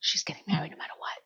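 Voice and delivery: fast secretive whisper; whispered aside. Acted. Vlogger confiding to viewers about Charlotte, quick whisper. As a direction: lean in and whisper it to the audience.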